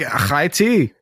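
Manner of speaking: "High tea" read with a throaty 'kh' at the start; scraped first sound on "High"; guttural Hebrew-style ch. Retold or imitated. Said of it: "it said high".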